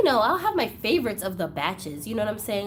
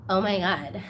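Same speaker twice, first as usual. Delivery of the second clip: usual speaking voice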